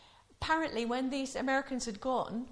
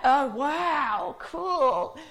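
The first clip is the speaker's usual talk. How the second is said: 1 impressed American; 2 semitones higher, 8 dB louder, much slower than usual. Retold or imitated.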